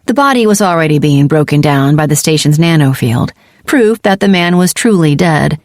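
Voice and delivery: usual speaking voice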